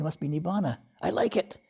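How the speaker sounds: a self-satisfied meditator thinking aloud; smug inner thought. Retold or imitated.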